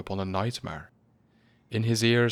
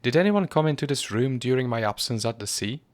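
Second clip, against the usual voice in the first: higher and louder, pointed question; high sharp voice; 5 semitones higher, 5 dB louder than usual. A narrator's reading. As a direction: sharpen it to the butler. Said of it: "speaking in a high, sharp voice".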